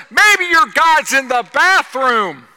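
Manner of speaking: high, loud, drawn-out punch line; full-force mocking proclamation; shouted taunt. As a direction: bellow it slowly at the rival prophets.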